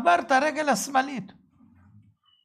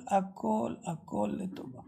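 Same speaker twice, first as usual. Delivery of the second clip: breathy trailing whisper; preacher fading his refrain to a whisper; 4 semitones lower, 9 dB quieter, slower than usual. Real, not acted. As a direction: let it fade to a whisper.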